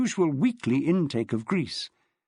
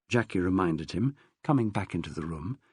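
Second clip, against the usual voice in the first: plain reading voice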